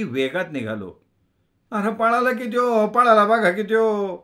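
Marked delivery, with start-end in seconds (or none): 1.7-4.2 high, loud rustic yell; milkmaid shouting. A narrator's reading. The milkmaid yells as the narrator flees on his cycle. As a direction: shout that he is getting away. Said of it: "ती गवळण ओरडत होती".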